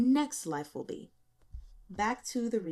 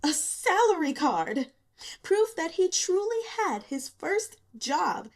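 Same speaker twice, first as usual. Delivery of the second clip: higher, louder and slow, swelling with pride; proud excitement; 8 semitones higher, 5 dB louder than usual. A narrator's reading. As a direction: savour it like a treasure, beaming. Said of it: "he felt an inexplicable pride".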